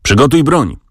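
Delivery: narrated command call; relayed military command. A narrator's reading. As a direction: call out a drill command, without full volume. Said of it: "rozległo się po szeregach".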